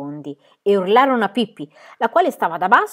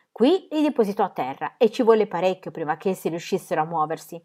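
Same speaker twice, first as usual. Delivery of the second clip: ordinary delivery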